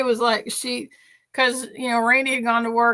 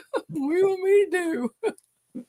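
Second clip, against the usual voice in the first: higher put-on dog voice, laughing through it; shrugging dog voice; 8 semitones higher than usual. Owner voicing the dog answering her scolding, laughing high and innocent. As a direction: play the dog, helpless and innocent, while cracking up. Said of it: "he just looked at me like".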